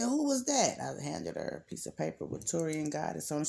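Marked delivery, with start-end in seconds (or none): 0.0-0.8 high, curious woman asking; woman's voice. Retold or imitated. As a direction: pitch it up like the surprised woman.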